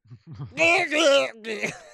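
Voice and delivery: loud, high garbled vomiting noise; mock Southern gibberish. Announced impression of a Southern man, loud garbled noises. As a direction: retch out wordless syllables as a caricature Southerner. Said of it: "Here's my impression of a Southern man".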